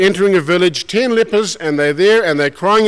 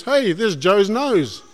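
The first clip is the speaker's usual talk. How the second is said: mock taunt; 3 semitones higher, much slower than usual. Neighbours mocking a leper.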